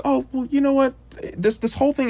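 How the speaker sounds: a mocking high-pitched voice parodying the team. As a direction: pitch it way up, sneering at the others. Mocking everyone's attitude, high voice.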